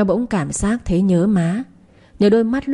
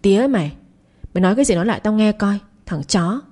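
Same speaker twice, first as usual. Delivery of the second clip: ordinary delivery, nothing marked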